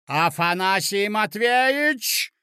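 shrieking call. A narrator's reading. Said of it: "взвизгнула ... неестественным голосом".

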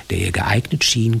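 ordinary delivery

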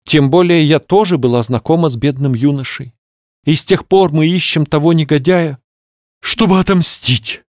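rising dramatic exclamation; exclaiming. Acted. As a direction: cry it out for revenge. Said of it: "воскликнула госпожа Полненьких". (восклицая)

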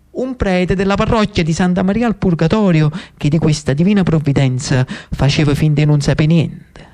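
normal talking voice

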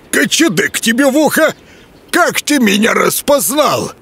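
high, wild wood spirit; startled forest spirit. A narrator's reading. Leshy voiced by narrator.

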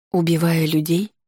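hoarse, catching question. A narrator's reading. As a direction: ask it with the voice gone hoarse. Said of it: "Голос мой от чего-то осип".

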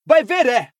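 high, loud angry outburst; bellowing. Acted. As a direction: yell it bitterly. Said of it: "berrou Harry".

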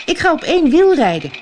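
high, showy call. A narrator's reading. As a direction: call it out as a proud announcement. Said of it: "riep ze".

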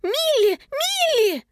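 high-pitched, climbing calls of alarm; high cry of dismay after a runaway; horrified cry. A narrator's reading. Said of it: "в ужасе закричала Анника".

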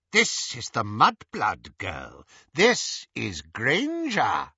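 slow, deliberate identifying. A narrator's reading. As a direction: draw out each word with intent. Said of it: "she said quietly".

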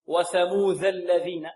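وثموذ الذين with DH in both words; wrong د as ذ. Shown as a demonstration. Teacher imitates how some readers err, DH in وثموذ الذين. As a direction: let the tongue slip to ذ in وثموذ before الذين. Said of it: "البعض يقول هكذا".

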